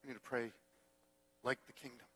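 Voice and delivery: soft, low, solemn point; quiet, measured exhortation; hushed preaching. Real, not acted. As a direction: drop the voice right down for the lesson. Hushed point after the story.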